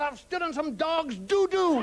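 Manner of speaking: high, slow, hammed-up complaint; mocked childish dismay. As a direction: wail it like an overgrown child. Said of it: "a grown adult man".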